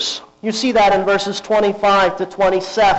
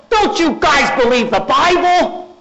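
higher, slower incredulous question; incredulous rebuke; 9 semitones higher than usual. Retold or imitated. Jesus chiding the disciples, higher incredulous question. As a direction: ask it in disbelief, drawn out.

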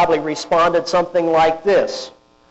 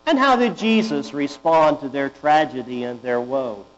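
usual speaking voice

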